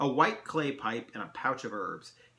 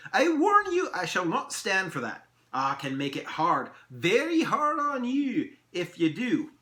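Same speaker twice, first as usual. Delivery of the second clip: high, loud elf threat; indignant elf laying down the law; chilled warning; 8 semitones higher, 5 dB louder than usual. Acted. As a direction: warn them sternly, leaning slowly on "very hard". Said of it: "His expression and demeanor markedly chilled".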